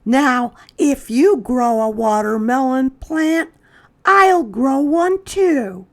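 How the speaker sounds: put-on a boy comforting his scared friend, high and loud; Chester's voice. A narrator's reading. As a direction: reassure a friend in a high child voice. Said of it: "said Chester".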